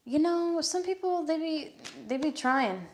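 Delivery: high, sing-song sassy put-on voice; sassy jab.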